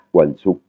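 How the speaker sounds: Wansuk with a short "oo" in "suk"; short "ou" vowel. Shown as a demonstration. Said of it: "une voyelle courte, qui est le ou". (Wansuk, « ou » court à la fin)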